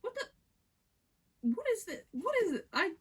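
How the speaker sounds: high, loud flustered "what is it"; flustered squeal. Real, not acted. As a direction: squeal it out, embarrassed. Flustered by a kissing scene.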